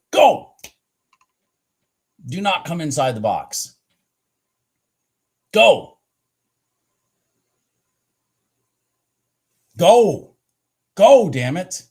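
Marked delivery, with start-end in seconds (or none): 0.1-0.5 sudden high loud yell; cheering yell. Real, not acted. Urging trade on screen. 5.5-11.8 high loud urgent yells; will the price to move, shouting; yelling go. Real, not acted. Urging trade on screen.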